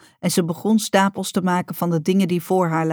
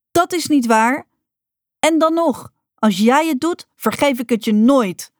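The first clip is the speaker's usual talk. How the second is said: heated outcry, much higher; calling out; 7 semitones higher, 4 dB louder than usual. A narrator's reading. Rocca to her mother, calling out in anger. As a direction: shout the threat at her. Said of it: "riep Rocca".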